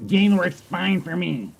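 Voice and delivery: slow, smug mocking voice; mocking whiny gamer. Mocking selfish gamer response.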